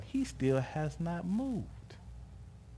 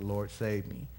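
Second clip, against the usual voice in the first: usual speaking voice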